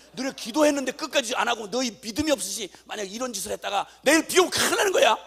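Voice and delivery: building to a loud, high scolding; stern warning. Retold or imitated. Father scolding daughters not to complain, stern loud warning.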